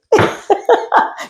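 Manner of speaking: high laugh. Real, not acted. Laughing at own slip.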